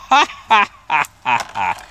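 loud, high ha-ha-ha falling in pitch; big scoffing laugh; mocking laugh. Real, not acted. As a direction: laugh derisively in separate bursts. Laughs derisively at the label.

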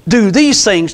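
ordinary talk

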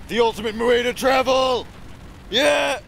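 big whooping cheer, high and loud; thrilled gamer whoop; excited shout. Real, not acted. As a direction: yell it like the ride of your life. Cheering wild ride in game.

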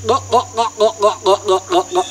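imitated glugging noise; steady run of throaty glugs; monkey miming drinking. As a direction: mimic a monkey acting out gulping a drink. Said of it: "And the monkey went".

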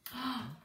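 short excited intake of breath; dramatic gasp. Acted. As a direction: gasp in delighted surprise for children. Mock surprise before reveal.

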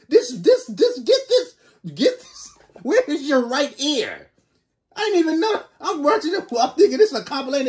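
high-pitched, loud comic bewilderment; baffled outburst. Real, not acted. Reactor confronts a stranger popping up in the compilation, baffled outburst.

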